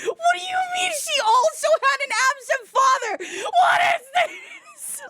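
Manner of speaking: high squeals through real laughter; laughing disbelief. Real, not acted. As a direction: gasp it out while cracking up. Cracking up at absurd comment.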